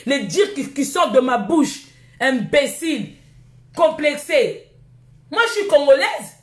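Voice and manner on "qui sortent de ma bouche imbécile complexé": ordinary talk, nothing marked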